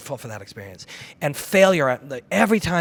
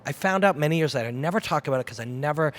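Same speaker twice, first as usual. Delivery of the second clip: ordinary talk, nothing marked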